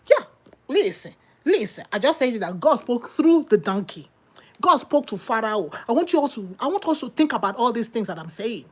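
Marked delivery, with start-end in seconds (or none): none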